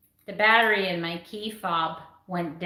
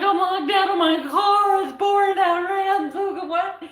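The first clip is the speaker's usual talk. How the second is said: high, loud mock wail; imitating a panicked, whiny cry for help; tearful retold phone call; 10 semitones higher, 5 dB louder than usual. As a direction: wail it like a hammed-up plea to a husband. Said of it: "I called my husband in tears".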